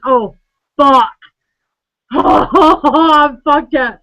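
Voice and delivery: loud, slow panicked yell; panicked shout. Retold or imitated. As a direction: yell it out in horror. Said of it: "they're going".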